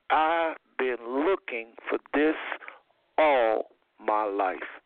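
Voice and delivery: slow, spaced-out quote; heartfelt testimony. Retold or imitated. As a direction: draw it out phrase by phrase, with pauses. Quoting people moved on arrival there.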